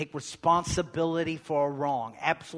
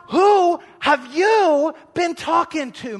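much louder and higher, slow, WHO driven down hard; climactic shouted question; 13 semitones higher, 12 dB louder, slower than usual. Acted. Preacher's final cry to the congregation, loud and slow. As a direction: throw WHO at them as the peak.

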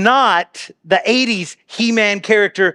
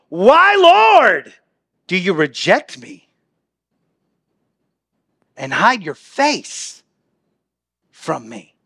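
anguished plea; slower than usual. Acted. Anguished psalm question.

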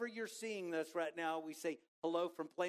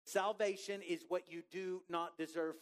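usual speaking voice

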